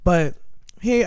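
ordinary talk, nothing marked

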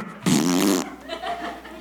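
real laugh. Real, not acted. Laughs at ground farting.

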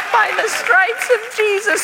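very high, loud, slow squeak; hammed-up little-kid squeal; puppet child voice. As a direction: parrot it like a tiny puppet. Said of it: "a little puppet voice when I was a child".

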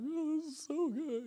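high, choked-up imitation of mom crying; imitating teary mom. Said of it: "she's crying".

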